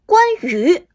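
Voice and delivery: heroic game announcer line; booming hero pick voice. Acted.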